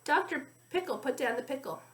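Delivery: ordinary delivery, nothing marked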